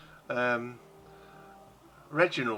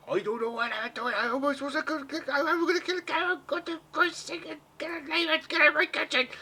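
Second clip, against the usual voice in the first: high, frantic halfling voice; panicky chef shouting. Acted. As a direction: shriek it like a chef losing it. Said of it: "you hear some sort of, like, shouties".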